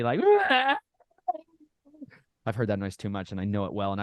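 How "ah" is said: high, loud imitation of a colicky baby crying; whiny baby squall, put on; mock baby wail. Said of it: "whining and griping like babies".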